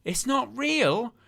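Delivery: high, slow fake disbelief; sarcastic gasp of fake surprise; mock shock. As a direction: pretend to be stunned, pitch leaping up. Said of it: "You go".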